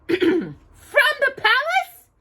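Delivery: very high, slow astonished question; gasping. A narrator's reading. Anastasia hears of the palace letter, surprised gasp. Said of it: "Anastasia gasped".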